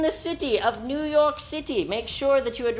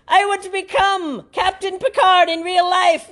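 mock grand speech; 6 semitones higher, 6 dB louder than usual. Grand mock speech about space.

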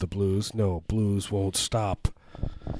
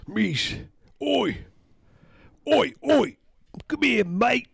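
put-on much higher, shouty Cockney accent; 4 dB louder, slower than usual. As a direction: call out like a Cockney bloke. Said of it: "He's a working class Cockney accent".

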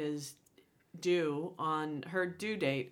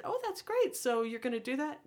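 much higher, bright mock cheer; mock delight; 9 semitones higher than usual. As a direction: fake enthusiasm at her plan. Mock delight at coworker's plan.

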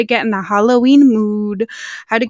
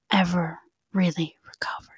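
whispering; 3 semitones lower, 7 dB quieter than usual. Acted. Ghost story, whispered line.

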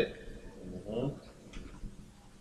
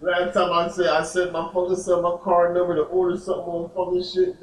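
normal talking voice

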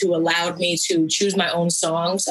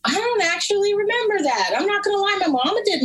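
sheepish; 13 semitones higher than usual. Real, not acted. Sheepish admission about taxes.

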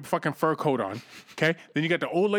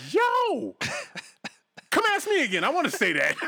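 excited high squeal; 12 semitones higher than usual. Retold or imitated. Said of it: "I was like".

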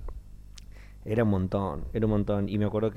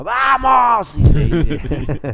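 very high, loud rallying shout; excited cheer; 14 semitones higher, 13 dB louder, much slower than usual. Retold or imitated. Actor psyching himself up for a second show, shouting. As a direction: fire everyone up.

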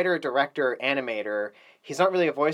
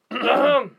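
loud, deliberate throat clearing; demonstrated throat clear; 9 semitones higher, 5 dB louder than usual. Shown as a demonstration. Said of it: "I can't clap and then go".

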